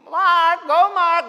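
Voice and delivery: loud, high cheer; cheering shout. Retold or imitated. Mother yelling for her son, loud high cheer. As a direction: yell his name proudly.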